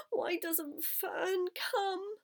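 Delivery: high, drawn-out plaintive wail; narrated tearful plea; near tears. A narrator's reading. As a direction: plead like a piglet about to cry. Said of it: "he began to cry".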